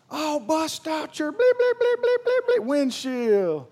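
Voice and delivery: high-pitched imitation of a cussing driver, censor bleeps squealed; mock angry yelling. Preacher imitating the driver cussing at him, mock yelling. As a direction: yell like a road-rager, bleeping out the curses. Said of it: "he's yelling at me, and he's cussing at me".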